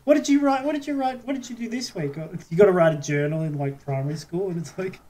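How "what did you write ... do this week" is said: put-on higher, sing-song teacher quizzing kids; lightly hammed-up schoolteacher checking journals; mock teacher voice. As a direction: play a primary teacher asking about the week. Mock primary school teacher asking.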